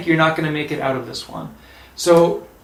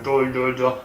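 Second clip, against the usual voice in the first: lower, monotone "duh, duh, duh" as over a microphone; microphone voice imitation; 4 semitones lower than usual. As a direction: mimic garbled announcements on a mic. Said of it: "somebody on a microphone saying".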